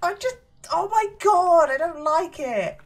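high, loud frightened cry; scared. Real, not acted. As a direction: recoil in real fright. Scared by horror game.

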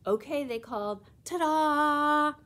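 loud, high "ta-da" shout; excited reveal. Acted. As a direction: burst out with the surprise. Friends call out a surprise.

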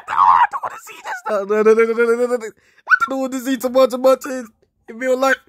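high-pitched frantic refusal; high, comic recoil in disgust; mock horror. Real, not acted. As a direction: protest in a high, panicky squeal. Recoiling from a tomato-like image.

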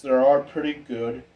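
usual speaking voice

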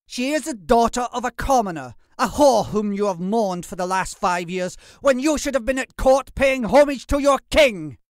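loud, high, scathing rant; shouted scorn building to the end; furious tirade. Acted. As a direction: pour out contempt at full voice. Percy's venomous tirade against the father.

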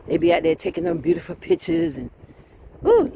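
usual speaking voice

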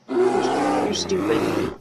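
low, very slow put-on dumb voice; mock dopey voice. To a panda, a low slow mock dopey voice. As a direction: drag it out low like a dopey giant.

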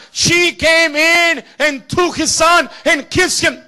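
high, loud shouted preaching; full-force preaching. Acted. Preacher to congregation, full-force shouted preaching.

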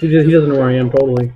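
usual speaking voice

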